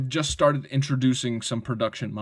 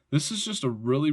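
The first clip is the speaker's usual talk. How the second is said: ordinary talk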